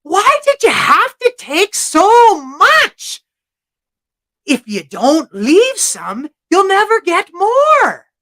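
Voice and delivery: high, loud, slow leprechaun tirade; shouted, drawn-out rebuke as a leprechaun; scolding leprechaun. Acted. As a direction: hammer out each word in exasperation.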